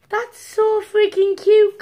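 high, loud and slow gushing. Real, not acted. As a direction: gush over how cute it is. Gushing over card.

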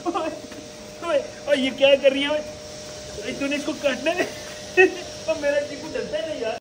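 high, startled scolding of the pet. Real, not acted. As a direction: shoo the animal off in alarm. Startled by animal biting. (ऊँची आवाज़ में चौंककर)

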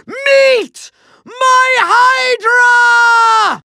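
very high, drawn-out villain yell; triumphant shout. Acted. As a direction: unveil the monster with a huge stretched cry. Villain unveils his monster.

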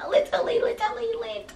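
a high squeal of literally lit; squeaky high repeat. Pretend. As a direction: pitch it way up like a cartoon squeak.

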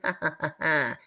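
taunting ha ha ha; mocking laugh. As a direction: laugh at her like a bully. Bully mocks Bonnie.

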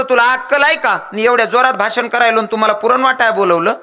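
high-pitched, indignant mock scolding; scolding husband. Party worker berates his wife at home, indignant high scolding.

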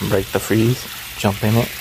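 ordinary talk

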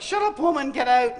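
loud, exasperated mock snap; annoyed Paul. Preacher voicing Paul fed up with the slave girl, loud and annoyed. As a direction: bark it like a fed-up apostle. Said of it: "It's like".

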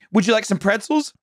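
high sing-song service voice; lightly voiced cheery attendant; flight attendant pitch. Mocking the snack cart attendant's high sing-song pitch. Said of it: "They're giving you the same pitch".

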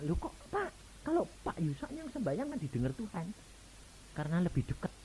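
put-on high, childlike whining voice; a lightly voiced naive child; childish pleading. Husband voiced as a child, high childish plea. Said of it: "Modelnya anak".

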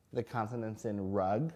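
usual speaking voice

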